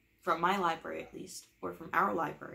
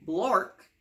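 silly nonsense word; 10 dB louder than usual. Acted.

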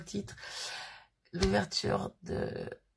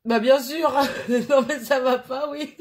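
laughing out the words, louder and higher; 5 semitones higher, 7 dB louder than usual. Real, not acted. Laughing at the twin's birthday card joke. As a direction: burst out laughing at the teasing.

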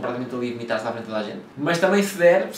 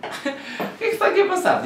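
high, laughing question; laughing disbelief. Retold or imitated. Vlogger recalling his shocked reaction at the scene, laughing. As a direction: laugh through the words in disbelief. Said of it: "só o parvo é que fez tipo".

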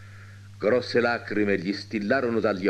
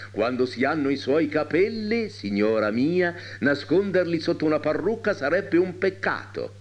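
mildly gallant, higher-pitched compliment; blissful doctor; 7 semitones higher than usual. A narrator's reading. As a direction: flatter the lady with blissful admiration. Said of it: "con la beatitudine dipinta in tutto il faccione". (medico beato e galante)

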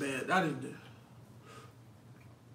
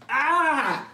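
pained yelp; 7 semitones higher, 6 dB louder than usual. Real, not acted. First bite of hot food.

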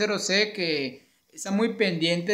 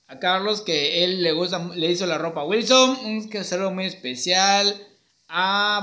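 ordinary delivery

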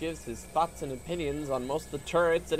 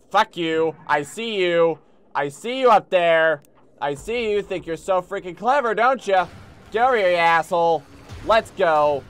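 loud, raised taunt at an enemy; heated, loud gamer trash talk; riled-up shouting; 5 semitones higher, 9 dB louder than usual. Real, not acted. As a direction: yell it at the foe up there. Yelling at an enemy in game.

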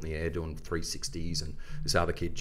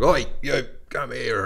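loud, higher shout across the park; calling out; 5 semitones higher, 5 dB louder, slower than usual. Retold or imitated. Calling the lunch thief over from across the park, loud. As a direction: call the bully over, sharp and loud. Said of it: "I'm like".

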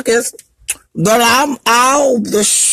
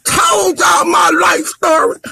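real sobbing, shouting high through tears; breaking down, voice cracking and much higher, sobbing out each line; crying rant; 6 semitones higher than usual. Real, not acted. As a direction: yell your hurt out through the crying. Breaks down crying while ranting online.

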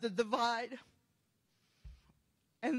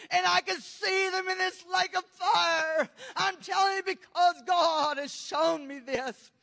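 cried-out testimony, pushing hard; fervent tearful preaching; 4 semitones higher, 6 dB louder than usual. Real, not acted. Preacher telling of demons taking people to hell, fervent tearful preaching. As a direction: preach at full force through tears.

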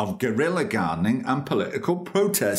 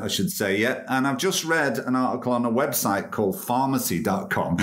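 ordinary delivery

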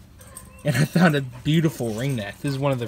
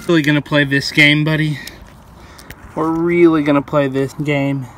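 exasperated; 7 dB louder than usual. Real, not acted. Snake escaping, exasperated.

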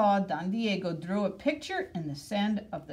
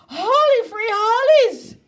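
much higher, much louder exclamation; excited cry; 14 dB louder than usual. A narrator's reading. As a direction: yell it as the bandit looms. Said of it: "cried Skipito".

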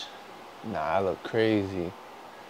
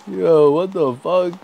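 loud, slow, drawn-out outcry; disbelieving shout; 7 semitones higher, 10 dB louder, slower than usual. Real, not acted. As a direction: yell it out in disbelief.

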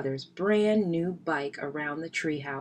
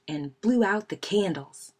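ordinary delivery

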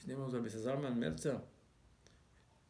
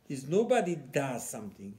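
ordinary talk

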